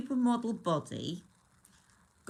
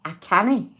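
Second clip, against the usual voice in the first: loud put-on Scottish; exaggerated Scottish, much louder; mock Scottish accent; 2 semitones higher, 13 dB louder than usual. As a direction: call it out in an imitation Scottish accent. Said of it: "is from Scotland".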